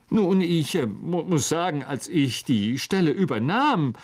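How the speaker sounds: ordinary delivery, nothing marked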